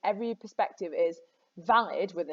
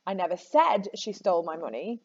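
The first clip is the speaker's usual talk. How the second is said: "said" stands out from the rest; stress on SAID. Shown as a demonstration. Teacher shows students how meaning shifts, stressing "said". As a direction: hit "said" as the key word. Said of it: "where on earth you put the emphasis".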